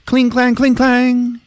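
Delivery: high ringing sound effects; sword-clash imitation. Training the boy to fight, high clanging noises. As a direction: chant the clashing of blades.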